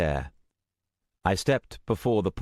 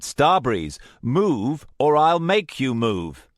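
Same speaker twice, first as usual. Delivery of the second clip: louder, slow menacing threat; angry threat; 8 semitones higher, 6 dB louder, slower than usual. Acted. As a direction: threaten her, word by word. Threatens Starbreeze.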